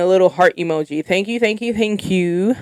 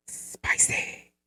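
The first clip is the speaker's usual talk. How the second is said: sultry whisper; 12 dB quieter than usual. Pretend. Reading a fan's comment back as a teasing whisper.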